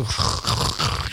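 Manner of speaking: harsh voiceless grinding of bad brakes; imitated brake noise. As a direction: imitate worn-out car brakes scraping. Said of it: "you can hear his brakes".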